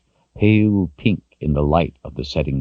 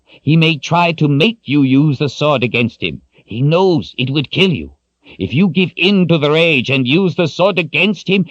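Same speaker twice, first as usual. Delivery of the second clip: put-on high, louder grave warning from the old wizard; stern old wizard; 12 semitones higher, 4 dB louder than usual. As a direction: lay down the hard rule to him. Zed explains the danger.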